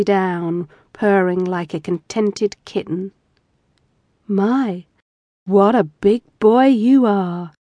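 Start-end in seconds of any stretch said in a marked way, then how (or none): 4.3-7.5 slow, husky murmur. Acted. Wife murmurs huskily to her husband. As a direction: linger on every word, husky. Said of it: "Jen said huskily".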